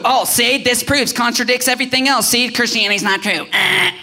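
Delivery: high-pitched mock gotcha; mocking skeptic. Preacher imitating people who take the surface, mocking. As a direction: scoff like a smug doubter.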